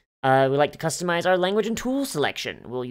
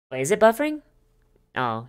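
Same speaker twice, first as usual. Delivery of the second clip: ordinary talk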